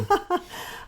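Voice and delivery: bright surprised laugh; high giggle. Real, not acted. Laugh at question.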